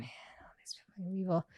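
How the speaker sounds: snide whispered aside. Retold or imitated. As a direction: whisper it under your breath. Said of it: "And I was like".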